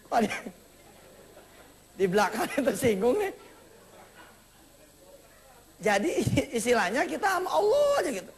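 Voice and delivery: can't help chuckling while preaching; laughing. Real, not acted. Preacher laughing at a listener's offence, words breaking into laughter. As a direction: talk through real laughter.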